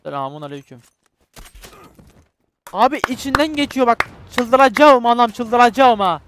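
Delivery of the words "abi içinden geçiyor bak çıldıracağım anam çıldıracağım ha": higher, much louder exasperated yelling; frustrated outburst. Real, not acted. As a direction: explode in frustration. Frustrated gamer losing it.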